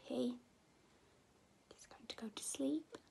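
slow, whispered drowsy line; hushed, sleepy and slow. Acted. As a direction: whisper like he's nodding off. Sleepy page whispered slowly.

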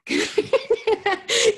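high, loud real laugh breaking out; burst of laughter. Real, not acted. Host laughing to her livestream viewers after the connection returns, high loud laugh. Back online after freezing.

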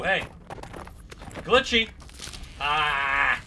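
ordinary delivery

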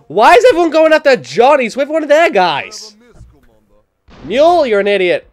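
loud, high outburst of protest; indignant shouting. Real, not acted. Player to enemies attacking John, indignant shout. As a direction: yell at them in outrage.

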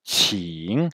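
QING opened with a puff of air; tongue-forward "Qing", drawn out and rising at the end; aspirated q. Shown as a demonstration. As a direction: burst of air, then long "ing". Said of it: "petite explosion d'air".

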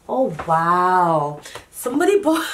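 a thrilled gasp of discovery; excited surprise. Real, not acted. Vlogger opening gifts from subscribers, squealing with delight.

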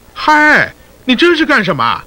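normal talking voice

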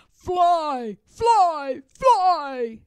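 clamor like greedy trolls. Acted. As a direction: trolls chant for flying. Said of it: "they clamored".

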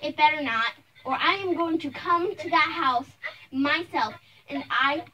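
loud, firm warning; stern threat. Acted. Mother warning the bully on the phone, stern threat. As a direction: warn forcefully, building on your threat.

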